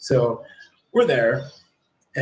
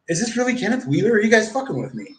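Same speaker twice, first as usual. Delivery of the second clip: high, fast, astonished quoted guard; guard asking if they're being fooled, high and rushed; disbelieving; 8 semitones higher, faster than usual. Retold or imitated. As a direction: squeak it out in disbelief. Guard can't believe who he is.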